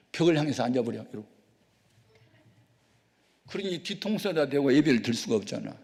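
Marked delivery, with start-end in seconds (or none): none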